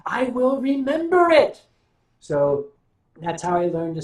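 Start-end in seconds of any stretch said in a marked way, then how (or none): 0.1-1.6 higher and louder, hammering the point; punchy emphatic claim; emphatic declaration. Real, not acted. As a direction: land it like a firm warning. Emphatic punchline about remembering curses.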